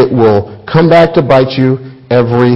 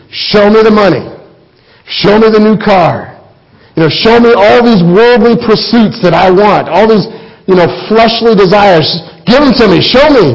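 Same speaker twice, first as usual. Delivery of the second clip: greedy demand; 7 semitones higher than usual. Retold or imitated. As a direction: demand it like a spoiled child. Voicing worldly greedy demands.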